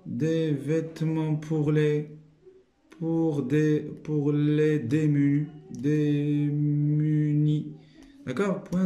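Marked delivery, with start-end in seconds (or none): 0.0-5.5 slow, word by word; stretched dictation pace. Shown as a demonstration. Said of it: "dictating slowly". 5.7-7.6 drawn out very slowly; slow dictation voice. Shown as a demonstration. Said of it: "dictating slowly".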